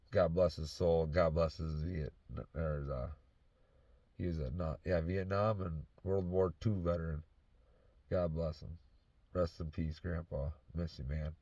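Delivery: low, soft, holding back real grief; choking up. Real, not acted. Grandson remembering his late grandpa, real choked-up grief. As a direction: speak quietly, trying not to choke up. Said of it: "Don't choke up on this".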